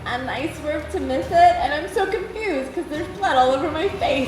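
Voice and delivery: put-on high, sugary innocent girl voice; sweetest girl voice. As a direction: play the sweet helpless girl for the cops. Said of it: "sweetest girl voice".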